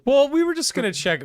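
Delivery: defensive. Real, not acted. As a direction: protest loudly, defensively. Player defends, voice jumps.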